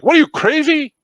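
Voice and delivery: high, loud incredulous dad; mock outraged father. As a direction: blurt it in disbelief. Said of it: "my dad's like".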